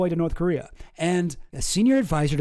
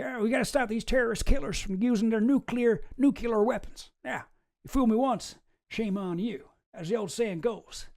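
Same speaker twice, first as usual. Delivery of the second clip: Texan twang, pitched higher, rambling; George Bush impression; 4 semitones higher than usual. Vlogger rambling through Bush quotes in his accent. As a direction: mangle the old saying like George W. Bush. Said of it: "what does he say?".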